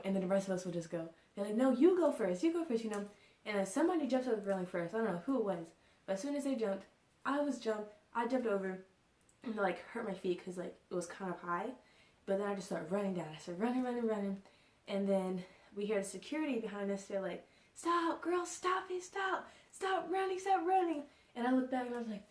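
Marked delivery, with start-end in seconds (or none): 1.6-2.8 higher, louder mock insisting; quick back-and-forth nudging, voice up; urging a friend. Friends urging each other first. 17.7-21.0 high-pitched mock calling out; mimicked guard ordering kids to halt; imitated security guard.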